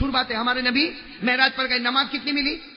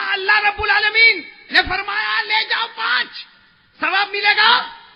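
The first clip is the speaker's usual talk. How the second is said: much higher and louder, ringing; proclaiming shout; 11 semitones higher, 9 dB louder than usual. Acted. Preacher proclaiming God's word to friends, shouted. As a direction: cry it out to the hall.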